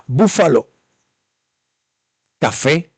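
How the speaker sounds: ordinary talk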